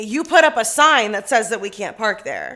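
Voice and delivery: loud, pointed acted-out demand; exasperated. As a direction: hammer home the obvious. Retold demand to landlord.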